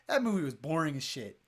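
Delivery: ordinary talk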